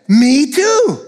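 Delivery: gleeful me too; excited me too. Acted. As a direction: brighten with glee at the match. Joke, excited agreement.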